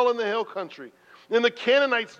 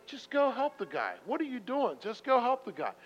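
higher urging inner voice; 4 semitones higher, 6 dB quieter than usual. Retold or imitated. Conscience urging him to help the stranger, higher. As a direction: urge yourself on. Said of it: "I'm fighting like".

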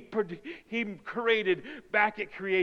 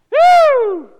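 loud, drawn-out woo; high excited whoop; 16 dB louder than usual. Acted. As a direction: burst out with a whoop. Tigger-like cheer.

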